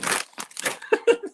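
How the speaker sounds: high airless burst of laughter; quick voiceless giggle; breathy laugh. Real, not acted. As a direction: laugh at your own silliness. Laughs at himself.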